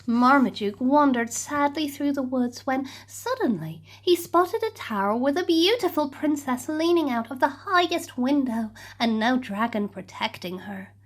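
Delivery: ordinary delivery, nothing marked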